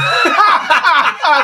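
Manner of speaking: loud, high laughing shout; burst of laughter. Real, not acted. Host bursts out laughing at the Oglaf answer, loud. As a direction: crack up out loud.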